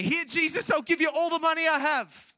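high, loud bargaining cry; over-the-top preacher's mock plea; mock pleading shout. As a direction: shout a desperate offer, mockingly high. Acting out what Jairus didn't say.

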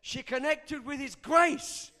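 slow, emphatic, rising preacher; full-force preaching. Real, not acted. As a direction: hammer the point home to the congregation.

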